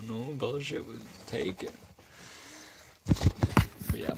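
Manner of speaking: normal talking voice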